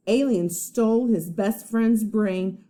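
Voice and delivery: usual speaking voice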